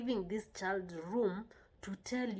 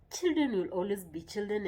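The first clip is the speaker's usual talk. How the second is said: normal talking voice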